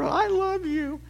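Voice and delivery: high, put-on voice of a clumsy husband blurting it out; hammed-up husband faking sincerity; mock blurted I love you. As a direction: toss off I love you like a cheap line. Mocking a husband's blurted line.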